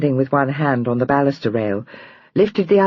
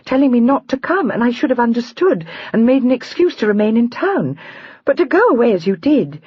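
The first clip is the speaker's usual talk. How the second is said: hurt complaint; 7 semitones higher than usual. Acted. Julia's hurt complaint to Adam.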